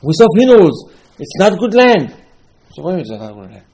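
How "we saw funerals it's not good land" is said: high, loud panicked warning; alarmed report. Acted. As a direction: blurt it out like frightened scouts. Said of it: "they come back and say".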